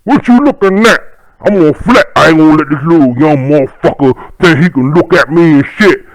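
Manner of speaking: high, loud flexing voice; posturing tough guy. Retold or imitated. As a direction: puff up and flex at the kid.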